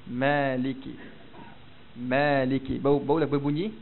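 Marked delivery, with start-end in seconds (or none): 0.1-2.8 "Maliki" low, long fronted "a" near "e". Shown as a demonstration. Teacher passes on his teachers' way, fronted "a" in "Maliki". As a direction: say "Maliki" with a light drawn-out "mae". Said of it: "ataupun sebut macam ni".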